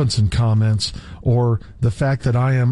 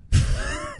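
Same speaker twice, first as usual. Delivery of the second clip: high, soft laughing squeal; high burst of laughter; 5 dB quieter than usual. Real, not acted. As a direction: crack up mid-sentence. Laughing while reading.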